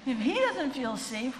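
high slow shaky fear, laughing; mock terror on doesn't; panicked echo. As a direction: repeat it as a panicked realisation. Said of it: "I'm like".

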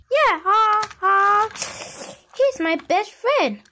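ordinary talk, nothing marked

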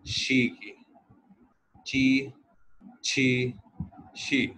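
शी की, ची, छी, शी drilled one by one; pinyin sounds. Shown as a demonstration. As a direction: contrast शी की, ची, छी, शी carefully. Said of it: "x sound देता है". (शी ची छी की तुलना करके उच्चारण)

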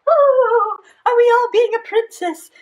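high, excited pretend princess. Storyteller asking kids who all play princess, princess voice.

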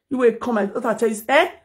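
usual speaking voice

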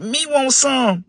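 high, very slow simple voice; slow brother. Acted. John begging his brothers, high and slow. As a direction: drag the words out like a slow little brother. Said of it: "he said".

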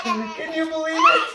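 high squeal after laughter; giddy disbelief. Real, not acted. Parent marvelling at the deal, high giddy squeal. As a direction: squeal it in amazement.

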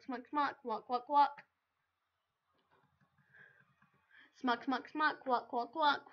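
loud chanted smack and whack; mock smack sounds. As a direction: chant the hits like a sound effect. Chanting game audio imitation.

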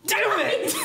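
put-on high, sharp childish cry of frustration; frustrated little girl. Little sister missing her ambush throw, cries out in high frustration. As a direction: yelp it like a kid who missed.

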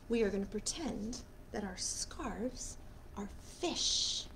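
soft whispered aside. Real, not acted. Librarian whispering to the children before the scarf song, hushed. Librarian sets up pretend game.

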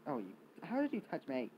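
ordinary talk, nothing marked

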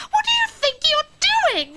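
high, indignant scolding; horrified. Acted. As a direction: demand an explanation, appalled. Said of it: "I think horrified is nearer the mark".

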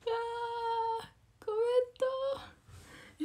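high, stretched-out wail of dismay. Real, not acted. Idol on a livestream wailing over a comment glitch. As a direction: moan at the broken comment feed.

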